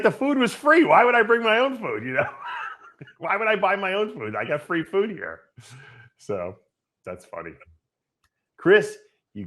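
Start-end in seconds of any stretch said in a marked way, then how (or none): 0.0-5.4 high hammed-up imitation, breaking into a laugh; mock Haney. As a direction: squeak it out like a cheeky guy who found free food. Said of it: "He's like".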